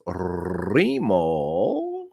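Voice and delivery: put-on old Italian boss answering the phone, long rolled R; Remo. Boss picks up the pizzeria phone, drawn-out Italian greeting. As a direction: roll the R and draw the name out. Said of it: "He always answered the phone like this".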